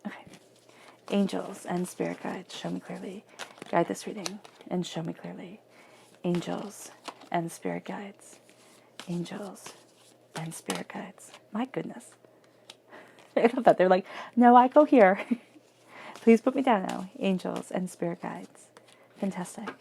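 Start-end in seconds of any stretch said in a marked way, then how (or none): 1.1-11.3 murmured prayer to angels, hushed and slow; soft invocation. Acted. Reader quietly invoking angels before the tarot spread. 14.4-17.1 playful card voice. Pretend. Cards voiced as talking back.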